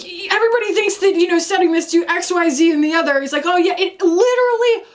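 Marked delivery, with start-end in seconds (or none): 0.0-3.2 high, quick imitation of irritation; mildly hammed-up annoyance; mock angry. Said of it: "he was, like, angry about it". 3.5-4.8 high-pitched retold grumbling; mildly hammed-up irritation; mock angry. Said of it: "he was, like, angry about it".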